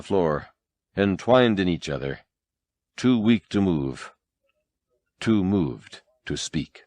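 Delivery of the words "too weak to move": plain reading voice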